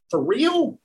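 can't believe he's being asked; incredulous. Retold or imitated. Asking himself whether to knock again, incredulous. Disbelief at inner prompting.

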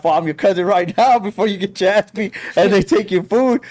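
urgent insistence. Retold or imitated. Retold urgent order to bullied kid.

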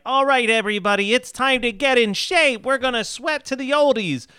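high-pitched peppy workout host impression; Richard Simmons. Workout video host greeting his class, high-pitched impression. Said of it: "pitch perfect Richard Simmons voice".

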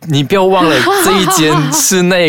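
音调偏高地模仿朋友训人; 带着玩笑意味学朋友骂自己; 转述朋友责骂. Retold or imitated. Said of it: "我朋友就直接骂我". (模仿朋友责骂的语气)

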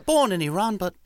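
a high, cheeky little English schoolboy, slow on the first line; mock British accent. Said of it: "ten-year-old Peter was in England going like".

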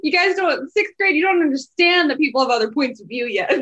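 high, loud, giggly; laughing. Real, not acted. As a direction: say it while laughing. Laughing reply.

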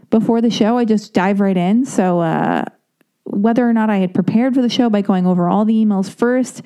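ordinary talk, nothing marked

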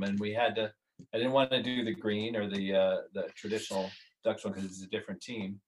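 normal talking voice

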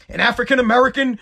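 ordinary talk, nothing marked

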